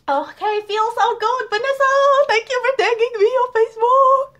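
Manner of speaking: very high, loud gushing squeal; giddy, high-pitched fan shriek; overjoyed squealing. Real, not acted. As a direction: squeal your thanks in delight. Overjoyed fan thanking a friend.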